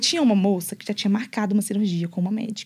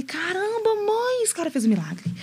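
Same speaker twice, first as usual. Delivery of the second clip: much higher, awed exclaim; astonished retelling to mom, pitched way up; amazed; 10 semitones higher, 4 dB quieter, slower than usual. Retold or imitated. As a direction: gasp it out like you've seen a miracle. Amazed at her own body.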